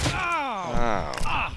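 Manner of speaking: sharp high "Ow" of pain; sudden squeaky ow; high yelp. Real, not acted. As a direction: yelp up high like you bonked your head. Character hurt in game.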